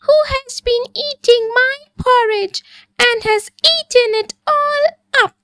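very high, gasping little bear voice; high, breathless baby bear voice; squeaky Baby Bear. A narrator's reading. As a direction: squeak it out like a tiny shocked bear cub. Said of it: "Baby Bear gasped".

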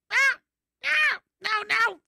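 high startled meow and no; startled yelp. Pretend. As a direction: yelp in surprise. Erschrickt über Katzenfilter.

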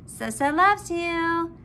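ordinary delivery

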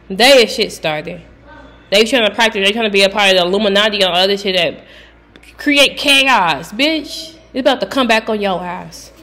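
loud, heated callout; heated rant. Real, not acted. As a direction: rant loudly at the culprits.

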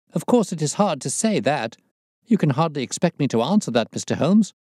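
high, flustered evasion; nervous. A narrator's reading. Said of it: "said the resident patient in a nervous fashion".

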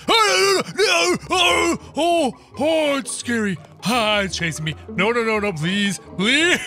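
high panicked shrieks; scared horror game streamer. Real, not acted. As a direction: scream as it chases you. Chased by monster.

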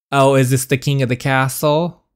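ordinary talk, nothing marked

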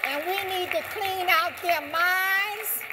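fervent preacher-style peak; rousing rally cry. Real, not acted. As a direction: call the crowd to action. Speech climax on clearing minds.

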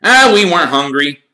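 high, loud, hasty cover-up; loud insistence. A narrator's reading. Ron covering for his rumbling stomach, loud. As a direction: blurt the excuse out loudly. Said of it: "said Ron loudly".

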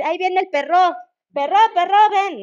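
every "perro" stressed on its final o, perRÓ; playful demo of "perro" with end-stress; wrong stress on last syllable. Shown as a demonstration. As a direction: call the dog with "perro" wrongly accented as perró. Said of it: "sería raro decir".

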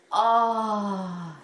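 long, teary sigh of gratitude; tearfully moved. Real, not acted. Crocheter receiving a treasured gift from her friend, tearfully moved. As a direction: let one drawn-out sound well up.

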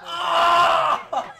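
high, drawn-out scream; real overwhelmed holler; excited yell. Real, not acted. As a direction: cry out in elation. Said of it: "I'm yelling so much".

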